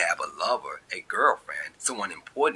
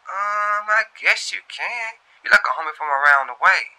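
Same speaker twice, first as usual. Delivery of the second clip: put-on high, louder, breezy girl on the phone; higher, louder young woman, casual and teasing; Shantae voice; 13 semitones higher, 8 dB louder than usual. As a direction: play it coy and easygoing with the new guy.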